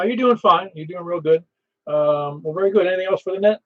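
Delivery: normal talking voice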